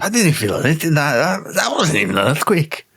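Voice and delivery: comic put-on Scottish accent; Scottish Italian accent. Co-host dismisses a level-one quake, in a comic Scottish accent. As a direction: dismiss it as a hammed-up Scot. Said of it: "Scottish Italian".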